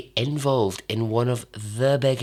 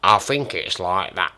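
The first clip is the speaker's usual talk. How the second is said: attempted Lancashire accent; 3 semitones lower than usual. Shown as a demonstration. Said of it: "that sounds like Manchester".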